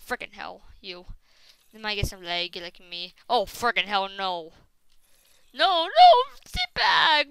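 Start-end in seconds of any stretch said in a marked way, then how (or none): 5.5-7.3 high, loud pleading; frantic high protest; panicked shout. Real, not acted. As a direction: yell to keep the attacker back. Warding off a zombie attack.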